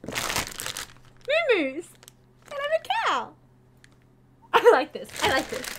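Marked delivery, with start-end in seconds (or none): 0.1-3.3 high cutesy cow voice. Pretend. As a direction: play a silly cow. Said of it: "I have to be a cow".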